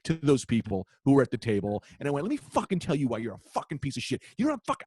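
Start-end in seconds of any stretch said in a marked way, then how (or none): 2.3-4.8 high-pitched tirade, climbing higher; retold flipping out. Comic back at the table, flipping out in high pitch. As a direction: re-enact an angry rant that keeps rising. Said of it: "flipped on these people".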